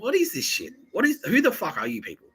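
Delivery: high-pitched mock bewilderment; retold incredulity. Teller reacting to strangers' meddling, high incredulous protest. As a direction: replay an exasperated, baffled outburst. Acts own bewildered outburst.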